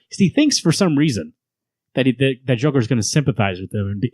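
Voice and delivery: ordinary talk, nothing marked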